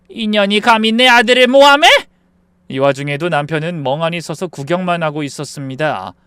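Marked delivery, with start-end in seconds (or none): 0.1-1.9 loud, high, indignant challenge; outraged shout. Acted. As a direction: roar in defence of your son. Mother-in-law outraged during brawl.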